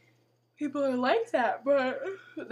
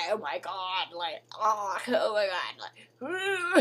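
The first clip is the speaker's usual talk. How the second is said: drawn-out, very slow mock gasp of shock; slow hammed-up oh my God at the checkout; 3 semitones lower, 4 dB louder, much slower than usual. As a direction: ham up horror at buying a tampon. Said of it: "then it's like".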